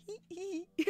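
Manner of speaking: high, soft imitated giggle; mimicking the player giggling while signing; imitating giggle. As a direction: giggle lightly like the shy player. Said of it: "And he's just like".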